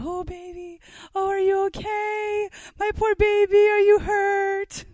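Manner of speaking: put-on high, loud gushing baby talk, slowed at the end; mock a fussing mother's baby voice; coddling mom. Mom rushing to her stumbling toddler, mock gushing.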